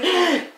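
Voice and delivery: loud, high mock gasp of alarm. Mother sees girl near stairs, alarmed gasp.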